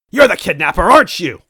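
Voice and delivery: high, loud accusing robot; robot crying out. Acted. Robot villain accusing the toad, crying out. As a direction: cry out the accusation. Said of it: "Yaredovik cried".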